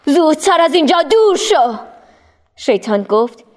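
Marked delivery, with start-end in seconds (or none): 0.1-1.7 angry shout. A narrator's reading. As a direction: drive the devil away in rage. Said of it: "با عصبانیت فریاد کشید".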